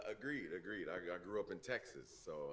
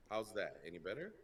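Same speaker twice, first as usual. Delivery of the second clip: normal talking voice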